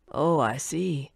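a lightly voiced old man musing; mildly voiced old man, slow and low. A narrator's reading. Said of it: "the old man said".

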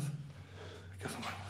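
slow frightened whisper. Real, not acted. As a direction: whisper it, hiding. Hushed while hiding.

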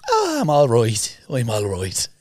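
slow, lightly put-on Irish accent; fake Irish accent. Teen declines food in a put-on Irish accent. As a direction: keep up a mock Irish brogue, slowly. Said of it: "And I'm like".

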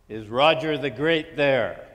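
slow, loud and commanding. Acted. Roger prank-calling a stranger, slow and commanding. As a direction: demand it like royalty on the phone. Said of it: "in a very commanding voice".

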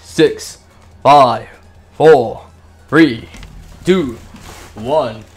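counting down loudly with the game; loud countdown. Real, not acted. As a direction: call out each number.

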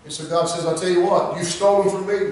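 high, chanted, sung-out preaching over the organ; half-sing each line to the church, slow and rising; whooping preaching. Acted. Preacher to the congregation about Christ's sacrifice, sung whooping preaching.

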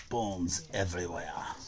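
normal talking voice

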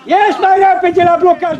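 loud, urgent coaching yell; shouted order. Real, not acted. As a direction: holler the instruction across the pitch. Coach calling orders to players. (ordin strigat de antrenor)

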